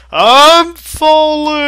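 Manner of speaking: long high panicked scream; falling mechanic yelling in terror; top of his lungs yell. Acted. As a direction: scream it as you plummet. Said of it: "the mechanic yelled at the top of his lungs".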